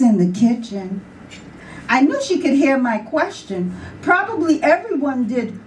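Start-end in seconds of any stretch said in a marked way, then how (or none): none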